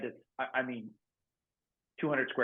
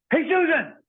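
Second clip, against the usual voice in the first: yank her awake with a sharp shout of her name; jolting yell to wake someone; sudden wake-up yell; 8 dB louder than usual. Retold or imitated. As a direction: bark the name like an alarm going off. Prankster yells to wake sleeper.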